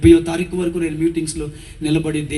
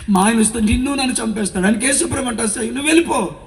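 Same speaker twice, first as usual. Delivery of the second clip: frightened plea; 7 semitones higher than usual. Retold or imitated. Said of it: "మా అమ్మ ఆవిడని చూడగానే భయపడిపోయింది".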